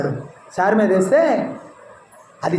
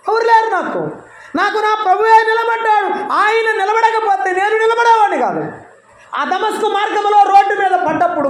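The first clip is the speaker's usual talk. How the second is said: preaching at full force; 11 semitones higher than usual. Acted. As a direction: preach it out to the congregation, raised and ringing. Preacher proclaims loudly. (బిగ్గరగా, ఎత్తైన స్వరంతో ప్రసంగం)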